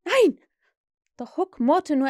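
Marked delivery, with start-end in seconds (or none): 0.0-0.4 high, loud pleading shout; terrified cry. A narrator's reading. Cowering pirate begs his captain, crying out. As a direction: cry out in refusal, climbing higher. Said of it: "machte sich ... klein und schrie".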